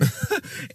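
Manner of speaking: a quick high-rising laugh; a single startled laugh; short real laugh. Real, not acted. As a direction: let a brief laugh escape. Laughing at the picture.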